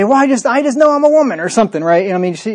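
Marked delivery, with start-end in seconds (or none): 0.0-1.4 much higher, put-on emotional voice; mock woman's voice. Preacher imitating a woman in a high mocking voice. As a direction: play a woman insisting out of pure feeling. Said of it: "She'd say".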